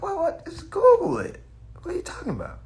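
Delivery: high, incredulous "just Google it"; a high, exasperated retort; mock disbelief. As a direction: act amazed it's so easy. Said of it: "I'm like".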